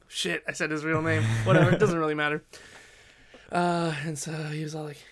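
ordinary talk

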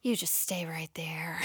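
whispered stern warning; mother's whisper. Retold or imitated. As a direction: hiss it low like a mom about to punish. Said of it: "our mother would just be like".

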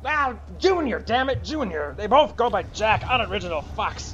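high grumbling character voice; exasperated crew worker. Acted. Worker cursing the two Jacks, high exasperated voice.

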